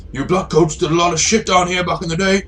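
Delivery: high raider leader voice; high-pitched road bandit with a grudge; gang boss. Acted. Gang leader accuses Jackal.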